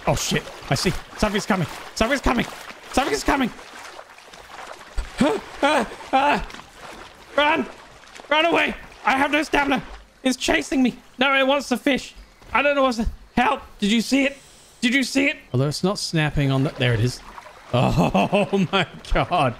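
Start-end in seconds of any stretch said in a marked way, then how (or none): 1.2-3.5 rising panic. Real, not acted. Threat approaching, rising panic. 5.2-13.6 panicked, high and fast; scared horror game streamer. Real, not acted. Fleeing a predator in game. 13.8-15.4 high, breathless disbelief; panicked. Real, not acted. Still panicked after escape. 17.7-19.5 slow laughing relief; relieved laugh. Real, not acted.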